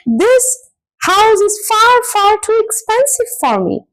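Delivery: "far, far" high and stretched out; emphatic repetition. Shown as a demonstration. Teacher showing repetition for strong feeling, emphatic "far, far". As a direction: complain emphatically, leaning on each "far". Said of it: "you're repeating the word".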